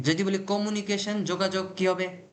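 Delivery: normal talking voice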